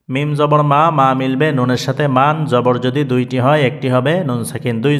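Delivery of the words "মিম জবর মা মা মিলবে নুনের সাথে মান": normal talking voice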